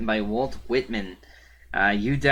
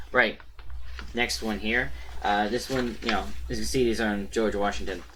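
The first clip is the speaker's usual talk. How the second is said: usual speaking voice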